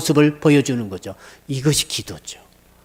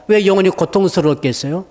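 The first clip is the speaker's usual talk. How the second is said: ordinary talk, nothing marked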